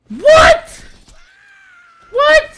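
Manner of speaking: very high, very loud incredulous yelp; shrieked what. Real, not acted. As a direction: shriek it in total disbelief. Outraged by company's reasoning.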